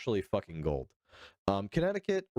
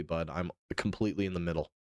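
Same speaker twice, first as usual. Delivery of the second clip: ordinary talk, nothing marked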